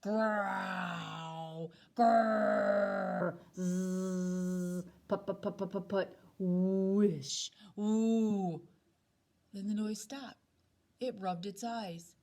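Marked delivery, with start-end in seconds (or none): 0.0-8.6 a string of growls, buzzes and whooshes; snoring noises. A narrator's reading. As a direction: act out a monstrous snore. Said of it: "the awful, terrible noise".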